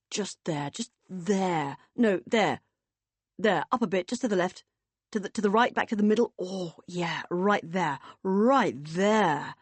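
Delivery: voiced bliss of a back scratch, emphatic; blissful directing. Man guiding his partner's back scratch, blissful. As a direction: guide the scratching, relishing each good spot.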